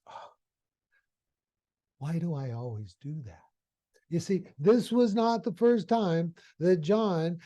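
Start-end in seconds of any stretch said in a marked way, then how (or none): 0.1-3.4 much softer, low self-reproach; regretful sigh. Retold or imitated. John, home alone, regrets his outburst, soft and low. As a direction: murmur it to yourself, full of regret.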